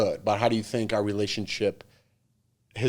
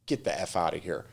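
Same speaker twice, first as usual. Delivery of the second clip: muttered; 6 semitones lower, 9 dB quieter than usual. Retold or imitated. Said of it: "I just go".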